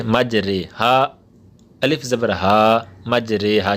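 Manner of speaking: letters spelled slowly one by one; slow hija recitation; slow spelling out. Shown as a demonstration. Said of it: "امالہ کے ہجے کس طرح".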